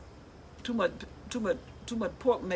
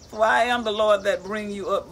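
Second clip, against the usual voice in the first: loud, proclaiming Bible reading; declaiming scripture from the book; 3 semitones higher, 7 dB louder than usual. Acted. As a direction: read the verse out like a preacher. Reads scripture aloud.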